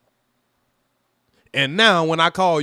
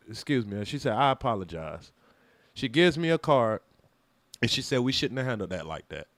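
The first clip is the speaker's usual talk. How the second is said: much lower, softer apologetic voice; soft apology; 8 semitones lower, 7 dB quieter than usual. Retold or imitated. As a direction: say it gently and small, like a timid apology.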